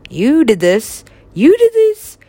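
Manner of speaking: from firm accusation to a high loud yell; accusing shout. Acted. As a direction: point the finger and scream it. Acted accusation, rising to shout.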